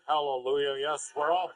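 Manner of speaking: mock-pious and subdued, dragging at the end; half-hearted churchgoer imitation. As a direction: praise with no conviction, slowing right down.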